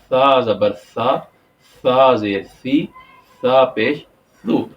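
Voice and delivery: سا said as tha with the tongue between the teeth. Shown as a demonstration. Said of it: "زبان کو دونوں دانتوں کے درمیان". (ثا کا درست تلفظ، دانتوں میں زبان)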